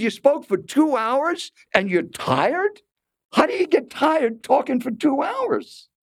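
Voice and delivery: high-pitched, scoffing disbelief; incredulous challenge. Retold or imitated. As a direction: imitate a stranger who can't believe it. Retelling seatmate's incredulous challenge.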